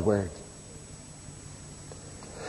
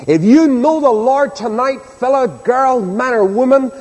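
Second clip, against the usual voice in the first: raised, emphatic pulpit voice; impassioned preaching; 5 semitones higher than usual. Real, not acted. Preacher tells congregation knowing the Savior is worth more, impassioned preaching. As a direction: proclaim it with weight on every phrase.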